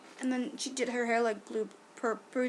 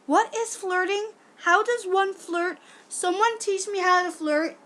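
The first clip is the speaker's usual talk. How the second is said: high, loud, drawn-out mock bewilderment; mock clueless; 8 semitones higher, 6 dB louder, slower than usual. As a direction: plead slowly and loudly, playing utterly lost. Mock cluelessness about flirting.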